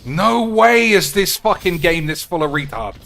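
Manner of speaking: loud furious swearing; raging at the game, yelling; angry rant. Real, not acted. Raging at opponent's deck.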